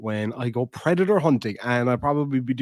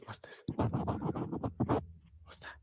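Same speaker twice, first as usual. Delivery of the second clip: whisper; 14 dB quieter, much slower than usual. Real, not acted. Whispering to dog.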